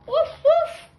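high, loud laughing woofs; playful high woof. Acted. Mother dog greeting her puppy with high laughing woofs. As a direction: bark it out playfully.

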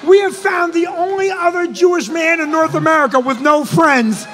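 exasperated storytelling climax; incredulous outburst. Retold or imitated. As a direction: blurt the awful realization at full volume. Comic outburst at story's reveal.

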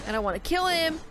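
usual speaking voice

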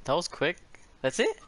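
high disbelieving squeak; surprised. Real, not acted. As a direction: caught off guard, pitch jumps up. Boss dies instantly.

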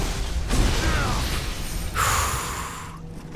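long, soft exhale; long sigh. Real, not acted. Gamer after beating a tough enemy, long sigh. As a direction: let out a long breath.

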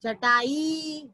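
चटाई stretched out with a long final ee; slow modelling. Shown as a demonstration. Teacher reminding children of the word for चा, drawn-out चटाई. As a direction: say चटाई very slowly, holding the end.

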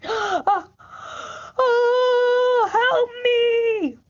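high pretend screams for help; screaming for help. Crying for help as the bear attacks, high screams. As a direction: scream as the bear mauls you.

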